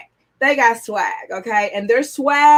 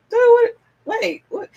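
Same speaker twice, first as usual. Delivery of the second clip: confused stoner; 11 semitones higher than usual. Retold or imitated. Mimics confused aloof person.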